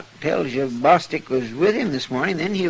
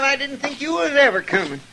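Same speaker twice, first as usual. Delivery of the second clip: normal talking voice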